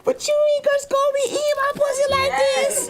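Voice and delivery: loud, very high falsetto; hammed-up high feminine imitation; mock woman's voice. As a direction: squeal it in mock protest.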